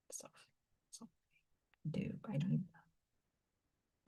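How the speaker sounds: hushed whisper. Real, not acted. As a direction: breathe it almost silently. Trailing confession to herself.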